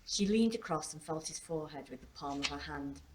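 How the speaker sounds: ordinary delivery, nothing marked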